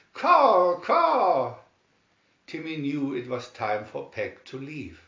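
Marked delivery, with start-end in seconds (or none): 0.1-1.6 loud, high excited caws; excited crow caw. A narrator's reading. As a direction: caw like a crow hopping with joy. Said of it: "excitedly hopping up and down".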